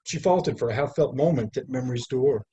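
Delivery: measured, lyrical poetry reading; poem reading. Acted. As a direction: read it like a poet at an evening reading. Reads poem aloud at reading.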